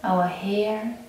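unhurried meditation guide, long pauses between phrases; guided meditation. Acted.

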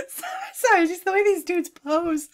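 high, squeaky words after laughing; helpless laughter. Real, not acted. Commentator apologizing over a pose, helpless laughter. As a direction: apologize while recovering from a laughing fit.

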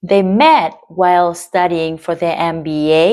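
slow, stressing "met", "while", "studying", "M B A"; stress met while studying MBA. Shown as a demonstration. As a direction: copy the rhythm beat by beat. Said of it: "người ta nhấn vào từ met". (nhấn vào met, while, studying)

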